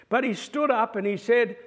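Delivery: ordinary talk, nothing marked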